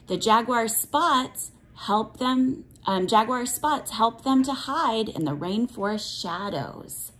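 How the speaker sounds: ordinary talk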